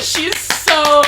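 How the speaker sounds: high, loud burst of admiration; laughing gush. Real, not acted. Gushing over the mother, laughing.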